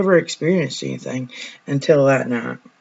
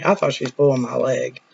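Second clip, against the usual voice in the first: ordinary talk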